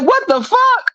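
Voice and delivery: very high, sharp disbelief; shocked squeal. Retold or imitated. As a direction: squeak it out, caught off guard. Shocked at blocked app.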